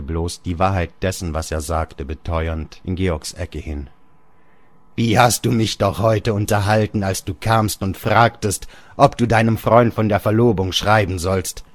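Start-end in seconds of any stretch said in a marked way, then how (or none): 4.9-11.6 louder, higher bitter reproach; scornful father. Acted. Father mocks Georg for asking about writing. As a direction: throw his own question back at him.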